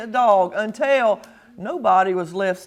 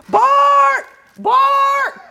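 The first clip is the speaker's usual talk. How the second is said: very high, loud bark; sharp barked word; dog bark; 13 semitones higher, 6 dB louder than usual. Acted. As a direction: bark like a watchdog. Watchdog barking.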